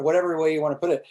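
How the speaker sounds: ordinary talk, nothing marked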